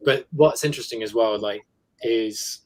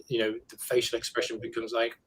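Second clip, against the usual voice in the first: usual speaking voice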